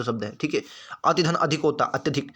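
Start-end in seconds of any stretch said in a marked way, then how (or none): none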